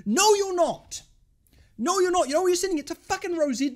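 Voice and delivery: high, loud heated contradiction; angry retort. Real, not acted. Fan answering a player's imagined boast, loud and pissed off. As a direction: shoot the boast down loudly.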